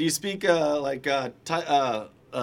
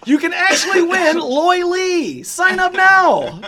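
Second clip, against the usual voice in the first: high, slow hammed-up commercial voice; mock ad pitch; 9 semitones higher, 3 dB louder, much slower than usual. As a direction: sell it like a cheesy TV ad, drawn out. Mock contest promo for guest.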